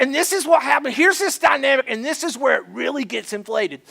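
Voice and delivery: ordinary talk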